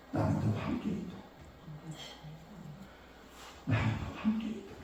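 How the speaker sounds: whispered, soft divine assurance; God's quiet voice. Retold or imitated. Preacher voicing God's answer inside him: soft whisper. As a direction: breathe it like a voice in the heart.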